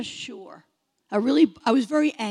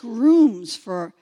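ordinary delivery

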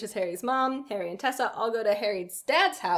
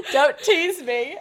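slow, louder mock male voice; badly faked heartthrob, slow and giggly; attempted man's voice; 4 semitones higher, 5 dB louder, slower than usual. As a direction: fake a man, cracking up. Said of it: "I can't do, like, a man".

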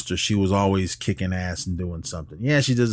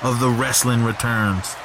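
booming ring announcer intro; grand entrance announcement; 6 semitones higher than usual. Acted.

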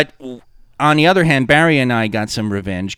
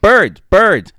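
mock urgent shout; 10 semitones higher, 9 dB louder than usual. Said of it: "at one point we're like".

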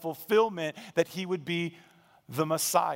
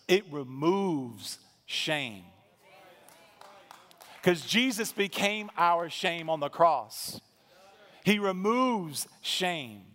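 slow emphasis; slower than usual. Acted. Preacher's emphatic key point.

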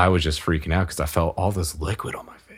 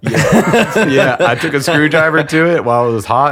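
higher and louder, laughing through it; 8 semitones higher, 8 dB louder than usual. Real, not acted. Husband admitting to his friend he did it again, laughing. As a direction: confess sheepishly through laughter. Laughing admission of repeat mistake.

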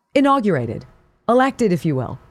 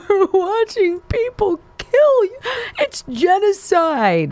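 high, slowing mock lament; mock Rashida Tlaib; 12 semitones higher, slower than usual. Host telling viewers she has more to cry about, a high, hammed-up lament. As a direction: lay the melodrama on thick. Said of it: "She's got more to cry about".

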